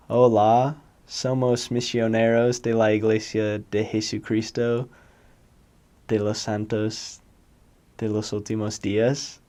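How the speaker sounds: stilted gringo missionary Spanish, phrase by phrase; gringo accent. Shown as a demonstration. Showing future missionaries how a greenie sounds, gringo accent. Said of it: "what a gringo accent would sound like".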